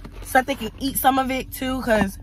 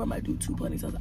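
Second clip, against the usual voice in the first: whispered aside to herself; 11 dB quieter, faster than usual. Real, not acted. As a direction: drop to a whisper. Repeats the idea to herself.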